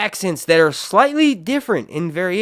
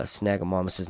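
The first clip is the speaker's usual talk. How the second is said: usual speaking voice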